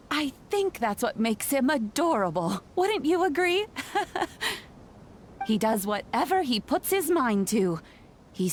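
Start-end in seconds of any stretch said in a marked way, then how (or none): 3.7-4.6 soft, high rising granny giggle; a fond little laugh; light chuckle. Real, not acted. Granny laughs after her question.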